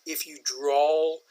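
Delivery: a slow, lazy drawl on each word. Shown as a demonstration. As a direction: stretch the words out slowly.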